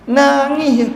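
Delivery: high, loud hammed-up whimper; preacher jokes about someone who only weeps, mock wail. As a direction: pretend to cry for comic effect. Mocking endless weeping.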